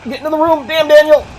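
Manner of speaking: high loud frantic yelling; frantic shouting. Real, not acted. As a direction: yell at him to hurry. Urging character into room.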